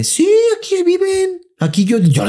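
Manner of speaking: very high, slow put-on lady voice; high proud housekeeper. Scene: housekeeper brags to neighbor, very high. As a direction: boast that you do everything.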